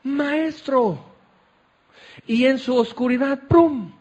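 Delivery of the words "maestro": awed whisper of recognition. Acted.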